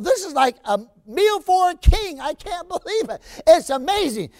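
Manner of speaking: a poor student marveling at the feast; awed delight. Retold or imitated. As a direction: gasp at the feast in wonder. Awed thought at the dinner table.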